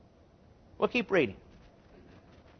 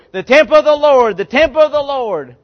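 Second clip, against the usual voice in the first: retold cry; 6 semitones higher, 7 dB louder than usual. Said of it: "They cried out".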